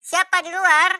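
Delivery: high, loud startled call; startled wife. Acted. Wife startled by a knock at night, high startled call. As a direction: call out in sudden fright. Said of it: "Istrinya kaget dan bertanya".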